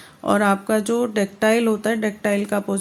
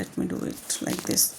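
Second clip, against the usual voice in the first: muttered aside; 8 dB quieter, much slower than usual. Real, not acted. Teacher mutters aside while writing.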